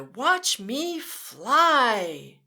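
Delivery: very slow, loud watch me fly; drawn out. A narrator's reading. Storyteller stretching the words for kids, slow. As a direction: stretch it like soaring. Reading to children.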